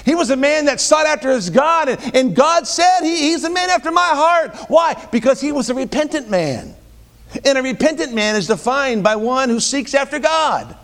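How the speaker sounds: high, driving sermon delivery; impassioned preaching. Real, not acted. Preacher on David as a repentant man, impassioned preaching. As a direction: press the point home with rising fervour.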